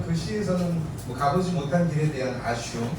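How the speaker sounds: usual speaking voice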